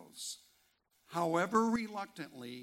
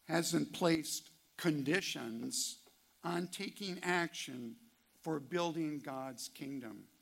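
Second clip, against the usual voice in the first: ordinary talk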